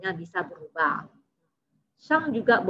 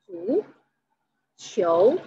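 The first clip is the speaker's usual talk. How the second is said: "Zuqiu" slowly, a long pause between "Zu" and "qiu"; syllable by syllable; 4 dB louder than usual. Shown as a demonstration. Teacher breaking down "Zuqiu" for learners, syllable by syllable. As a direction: split "Zuqiu" into two separate, drawn-out syllables.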